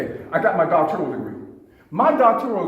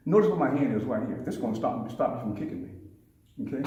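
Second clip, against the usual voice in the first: ordinary delivery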